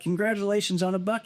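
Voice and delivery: high, sly mock congrats; teasing congratulations. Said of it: "I'm like".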